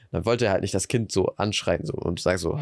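normal talking voice

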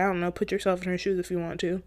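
ordinary talk

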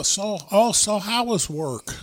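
high, slow, drawn-out Minnesota "how was work"; Minnesota accent. Retold or imitated. Narrator mimics mother-in-law greeting him, high slow Minnesota accent. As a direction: ask it like a chipper Minnesota mom. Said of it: "I love the fucking accent".

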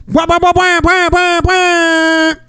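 high, loud wailing alarm noise; imitated alarm siren. Gym alarm going off during the panic, siren wail. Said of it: "everybody freaks out".

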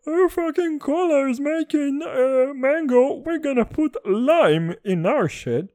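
high, loud mock voice of the rival cola maker; mocking Pepsi voice. Mocking Pepsi's makers to podcast listeners, in a high, loud put-on voice. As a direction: sneer at Pepsi as their smug cola boss.

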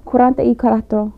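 quick, run-together Spanish "cuarenta y cuatro"; so fast. Shown as a demonstration. Guest shows hosts how forty-four is said, so fast. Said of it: "like, so fast".

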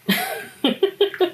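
amused high laugh. Real, not acted. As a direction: burst into a laugh. Laughs at own rant.

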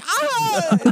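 high mock shriek of fear; mock frightened yelp. Husband pretends to fear his wife in public, mock shriek. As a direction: fake a flinch with a yelp.

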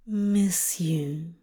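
soft whispered murmur. A narrator's reading. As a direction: murmur it into his neck. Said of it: "he murmured into the angel's neck".

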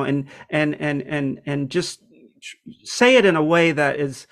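ordinary talk, nothing marked

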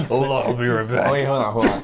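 ordinary talk

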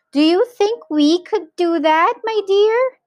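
high, loud questioning lilt; voiced wife. A narrator's reading. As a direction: ask your husband high, rising at the end. Wife voiced asking her husband.

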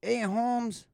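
high, loud mock gangster greeting. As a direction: call out like a kid playing gangster. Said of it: "these kids would go up".

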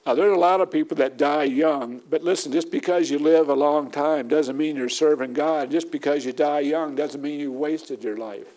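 ordinary delivery

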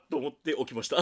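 usual speaking voice

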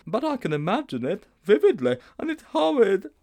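put-on high, loud boyish dread; high-pitched schoolboy dismay; horrified Sponge. Sponge imagines running downhill.

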